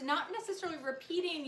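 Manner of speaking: usual speaking voice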